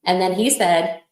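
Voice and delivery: ordinary talk, nothing marked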